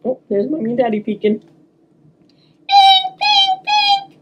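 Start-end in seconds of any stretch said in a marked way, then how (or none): 1.4-4.2 high, beeping alarm clock sounds, much higher; imitated alarm beeps. Alarm clock ringing to wake the girl, high beeping. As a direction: chirp it out like a tiny alarm clock. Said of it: "went my alarm".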